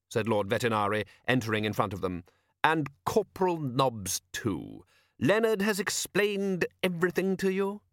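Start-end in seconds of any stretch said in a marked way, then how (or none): 2.6-7.8 slow, drawn-out greeting, rising high into the question; smooth patrician ruler. A narrator's reading. Patrician greets the watchmen and checks they're briefed, smoothly. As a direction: greet them with unhurried authority. Narrator voices Lord Vetinari.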